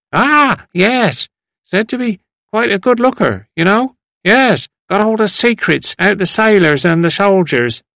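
put-on high, knowing old man's voice; old man reminiscing. A narrator's reading. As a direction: elderly gossip relishing a memory. Old man recalls the girl.

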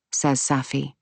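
ordinary delivery, nothing marked

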